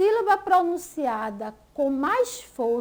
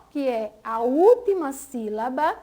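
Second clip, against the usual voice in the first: ordinary talk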